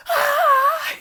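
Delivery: piercing high loud scream; terrified scream. Retold or imitated. As the stranger pees on her car, high terrified scream. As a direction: shriek in pure horror.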